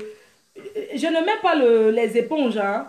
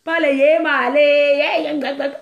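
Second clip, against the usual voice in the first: mock mourning wail; 4 dB louder than usual. Shown as a demonstration. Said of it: "Pas les pleurer du deuil".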